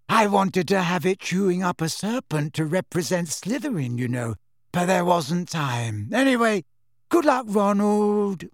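high, dreamy, drifting voice; dreamy Luna. A narrator's reading. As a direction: vague and cheerful, floating. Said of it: "a vague and dreamy voice".

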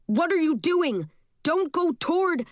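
high, urgent narrated yell, cut off; restrained yell. Acted. Matthew stopping a friend running toward the blast, restrained yell. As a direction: yell a warning without full volume, pitch well up. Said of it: "yells into my ear".

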